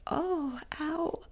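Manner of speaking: tender, wounded "Oh, ow"; vulnerable ouch. Shown as a demonstration. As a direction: let the real hurt show gently.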